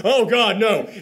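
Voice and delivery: a touch of horror at the twist; lightly acted dread; mock horror.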